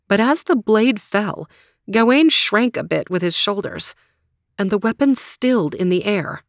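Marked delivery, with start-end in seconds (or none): none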